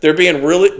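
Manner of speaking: ordinary talk, nothing marked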